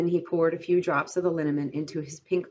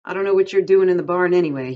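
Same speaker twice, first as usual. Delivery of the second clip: louder, fast complaining Crooks; 2 semitones higher, 8 dB louder, faster than usual. A narrator's reading. Crooks complaining Lenny is in the barn, loud and fast. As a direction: grumble it out quickly and loudly. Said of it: "he complained".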